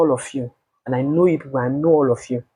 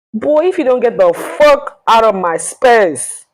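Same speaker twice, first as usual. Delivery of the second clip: retold furious yell; 9 semitones higher, 7 dB louder than usual. Yelling at ex in retold fight.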